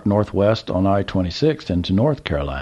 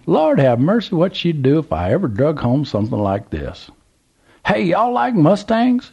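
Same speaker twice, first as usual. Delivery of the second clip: put-on old Southern gentleman; 8 semitones higher than usual. A narrator's reading. Old man calls to trucker, high Southern voice. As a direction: play the curious old man at the gas pump.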